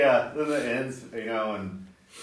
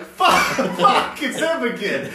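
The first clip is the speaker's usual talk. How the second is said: high laughing groan of dismay; fed-up fan laughing at the singer's return; laughing mock dismay; 10 semitones higher, 4 dB louder, slower than usual. Fans dismayed he's back.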